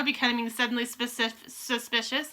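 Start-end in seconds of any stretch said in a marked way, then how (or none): none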